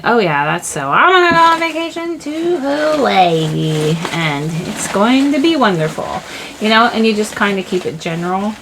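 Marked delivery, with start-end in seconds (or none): 0.0-6.2 high, swooping sing-song delight; carefree believer. Acted. Manifester announcing a Hawaii vacation, blissfully carefree. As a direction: sail up high, then glide down happily. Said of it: "zooming out and believing".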